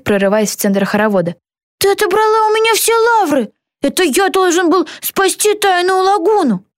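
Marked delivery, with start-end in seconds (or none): 1.8-6.7 high-pitched jealous protest; indignant shout. A narrator's reading. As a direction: protest high, robbed of the glory. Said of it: "закричал Стив". (возмущённо кричать высоким голосом)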